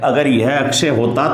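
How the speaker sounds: ordinary talk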